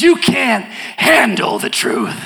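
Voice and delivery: very slow, seething movie-line delivery; Jack Nicholson impression. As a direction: spit the famous line back, slowly. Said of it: "And Jack Nicholson says".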